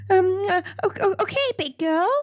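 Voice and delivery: a scared dentist facing a shark's jaws; nervous stammer. Acted. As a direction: stammer through it, terrified. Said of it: "he stammered".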